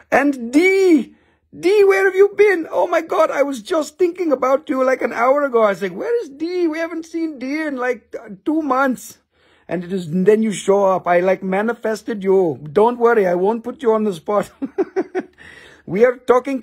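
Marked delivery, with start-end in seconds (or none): 0.1-9.2 excited greeting. Real, not acted. Streamer greeting returning viewer D, thrilled. 14.6-15.2 short high laugh; a quick amused giggle. Real, not acted. Streamer giggling to D, high laugh.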